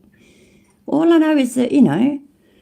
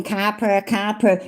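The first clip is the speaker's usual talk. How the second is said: "Capper, capper" with a flat American a, a light mock American accent; slower than usual. Mocking the YouTuber's American "coppers" in a light American accent. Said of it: "we don't say it with American accents".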